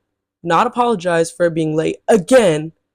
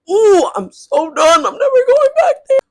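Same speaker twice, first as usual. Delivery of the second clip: teary outburst; 13 semitones higher, 7 dB louder than usual. Retold or imitated. Retold refusal to return.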